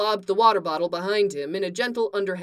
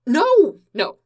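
startled denial; 8 semitones higher, 9 dB louder than usual. Acted. Clark's flustered denial.

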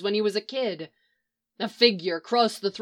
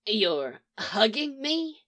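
slow, softer, muffled into a shoulder; muffled, concerned; 4 dB quieter, slower than usual. Acted. Leonard wrapped in Mick's bear hug, muffled concerned question. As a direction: speak into a hug, muffled and puzzled. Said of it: "Leonard's concerned voice ... muffled by Mick's shoulder".

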